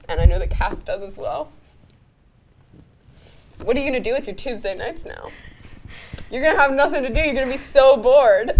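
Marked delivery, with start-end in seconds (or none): 6.3-8.5 high, mock-dramatic tease; playful teasing. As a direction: rub it in jokingly. Teasing loyal viewers.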